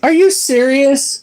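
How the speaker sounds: high, slow, incredulous question; surprised. Real, not acted. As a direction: sound genuinely disbelieving. Reacting to the cold air conditioner.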